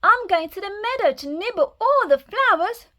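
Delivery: put-on high, squealing baby goat; high little kid goat answering; squeaky little goat. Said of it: "Baby Gruff squealed".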